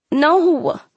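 high, drawn-out whimper. A narrator's reading. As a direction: whimper in helpless dread. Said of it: "I whimpered".

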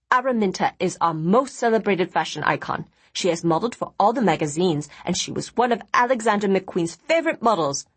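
usual speaking voice

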